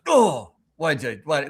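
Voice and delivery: slow, bewildered "oh, what?"; dazed. Retold or imitated. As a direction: sound like you just bumped into a wall unfazed. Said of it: "you will walk into a wall and be like".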